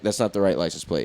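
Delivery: low, soft dismissive cop voice; mock cop voice. Imitating the cops looking at the photo, low and soft. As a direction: drop it low and dry like a cop who's made up his mind. Cops imagined judging the picture.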